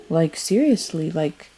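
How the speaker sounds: normal talking voice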